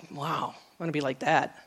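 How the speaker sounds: low, very soft admiration; retold hushed wonder; quiet awe. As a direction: murmur it as if to yourself. Recalls her hushed admiration of him.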